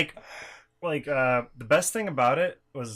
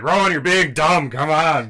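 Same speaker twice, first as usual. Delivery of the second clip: louder, exasperated teasing; mock annoyed sibling; scolding friend; 4 semitones higher, 10 dB louder than usual. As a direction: tell him off for being silly. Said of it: "I'm just like".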